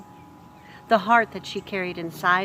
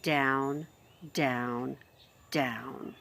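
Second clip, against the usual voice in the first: fading low; 4 semitones lower, 6 dB quieter, much slower than usual. A narrator's reading. Descending stairs, dropping voice.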